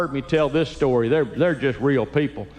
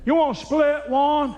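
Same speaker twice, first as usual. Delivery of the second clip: high, put-on old dad voice; dad's voice. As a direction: ask it like a thrifty old father. Dad's reply voiced in story.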